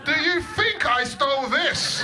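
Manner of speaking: loud, high and slow, half laughing; outraged at the copper, hammer it home; indignant rant. Acted. Ranting at police stop.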